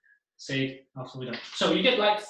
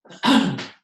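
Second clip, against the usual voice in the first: loud, short throat clear; 11 dB louder than usual. Real, not acted. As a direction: clear your throat hard. Clears throat before going on.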